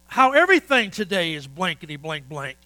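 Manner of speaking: loud exasperated rant; ranting about throwaway junk; retold cussing rant. Retold mechanic ranting loudly about throwaway parts. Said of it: "And he started cussing".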